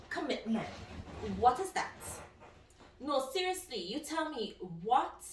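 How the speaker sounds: ordinary delivery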